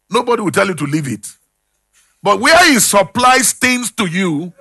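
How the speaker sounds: ordinary delivery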